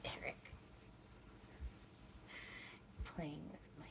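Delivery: hushed whisper aside. Real, not acted. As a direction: whisper it under your breath. Aside during channeling session.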